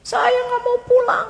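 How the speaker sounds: high, whining mock cry; imitated tearful refusal. Old man refuses to leave the tomb, tearful pleading. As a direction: plead like someone in tears.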